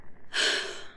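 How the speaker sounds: long breathy exhale; resigned sigh. Acted. Engineer gives in to using the robot, resigned sigh. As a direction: sigh it out before giving in.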